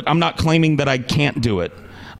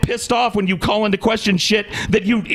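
higher-pitched, heated tirade; angry rant; 6 semitones higher than usual. Real, not acted. Podcaster railing at his co-host over touchiness, heated. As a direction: keep ranting over the interruption, voice raised in pitch.

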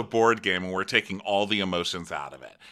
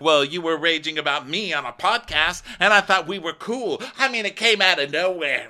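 higher, louder mock protest; hammed-up aggrieved retort; indignant imitation; 6 semitones higher, 4 dB louder than usual. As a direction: climb in pitch as the grievance builds. Acting out castmate's complaint.

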